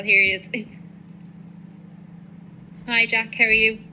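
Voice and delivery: usual speaking voice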